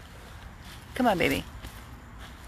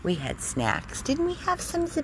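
usual speaking voice

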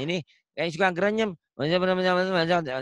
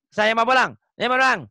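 rushed loud reading; 6 semitones higher, 5 dB louder, faster than usual. Shown as a demonstration. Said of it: "Latihan pelan-pelan".

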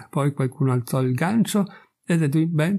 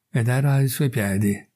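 plain reading voice

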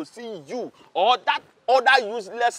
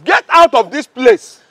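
loud, slow get out; shouted order; 10 dB louder than usual. Acted. Guard ordering the women from the gate, shouting. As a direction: drive her off the gate with a bellow.

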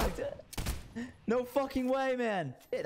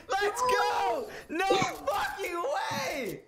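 excited shout; 13 semitones higher, much slower than usual. Real, not acted. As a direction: scream with joy at the play. Celebrating a big play.

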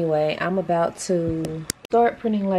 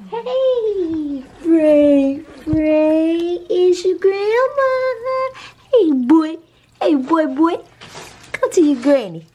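much higher and louder, sing-song pet talk calling a dog; stretched-out high baby-talk to a pet; doting granny voice; 10 semitones higher, 7 dB louder, slower than usual. Acted. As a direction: coo at the dog like an adoring grandma, high and loud. Calling her dog as its grandma.

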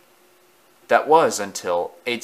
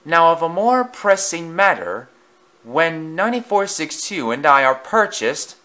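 sneering pompous engine; 7 semitones higher than usual. Acted. Bully taunting Edward about his character. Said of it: "boastful engine voiced".

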